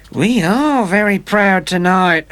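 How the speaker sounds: high, slow mocking woman's voice; sneer. A narrator's reading. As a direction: drag out the sneer at him, slowly. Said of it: "she sneered".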